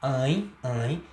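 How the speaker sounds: "ãe" nasalized, sounded out twice; nasal diphthong. Shown as a demonstration. Teacher showing what is not open, nasal "ãe". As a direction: send "ãe" through the nose and mouth. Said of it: "já não é caso de ditongo aberto".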